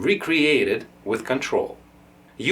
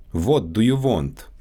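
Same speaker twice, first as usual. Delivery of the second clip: Russian accent, "What" and "want" with a rounded "wo" vowel; Russian accent, say "What do you want" as "vot do you vont". Shown as a demonstration. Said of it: "W and V sounds".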